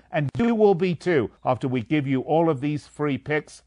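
ordinary delivery

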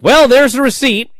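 loud, high ringside commentator call; imitated announcer. Host mimics the match announcer's call, loud and high. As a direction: belt it out like an excited announcer. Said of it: "the announcer's like".

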